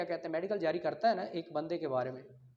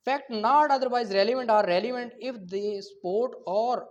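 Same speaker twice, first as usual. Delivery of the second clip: slow statute reading; 3 semitones higher, 6 dB louder, much slower than usual. Acted. Teacher to law students, loud slow dictation of the statute. Reads next statute aloud.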